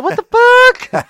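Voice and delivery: high, loud, drawn-out disbelief; mock shock. Friend retelling a baffled character's reaction, mock shock. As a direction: squeal it slowly in hammed-up shock.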